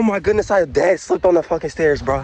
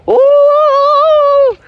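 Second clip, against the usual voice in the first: very high ooh then gasp; squeal and gasp; 4 dB louder than usual. Real, not acted. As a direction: squeal then catch your breath. Speeding down.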